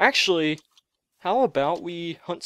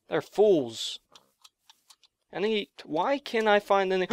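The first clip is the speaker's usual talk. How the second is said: usual speaking voice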